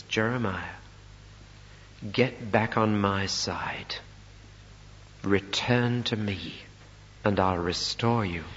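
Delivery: slow, low, firm command; firm God voice. Acted. God recalling his prophet, slow, low and firm. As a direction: call him back with unbending authority. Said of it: "He speaks very firmly".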